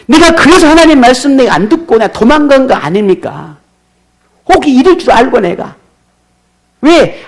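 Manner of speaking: high, loud indignant protest; Jonah's grievance. Retold or imitated. 도망친 요나가 하나님께 항의하며, 높고 큰 소리로. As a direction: argue back at God, raising the voice. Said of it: "요나는 마음에 있는 이 분노를 쉽게 잠재울 수가 없습니다".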